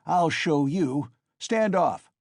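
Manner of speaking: frantic scream. A narrator's reading. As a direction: warn everyone back in a high shout. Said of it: "Mikolka screamed frantically".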